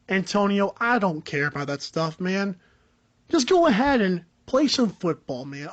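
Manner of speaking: low, dismissive coach, lightly voiced; Jon Gruden impression. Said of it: "a John Gruden impression".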